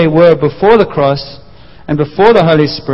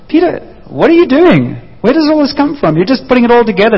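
amazed self-talk; 7 semitones higher than usual. Retold or imitated. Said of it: "Peter probably said".

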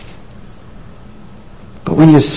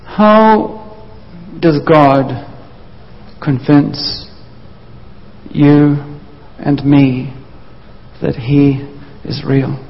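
slow, spaced question; much slower than usual. Shown as a demonstration. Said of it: "slow rhetorical question".

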